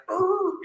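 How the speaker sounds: a strained, high mock grunt; grunt of effort. Janitor straining at the lake, mock high grunt. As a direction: imitate the janitor heaving trash out, half-joking. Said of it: "and he's like".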